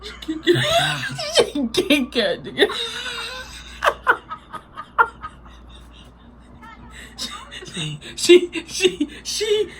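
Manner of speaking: laughing hard. Real, not acted. Cracking up watching slipping video.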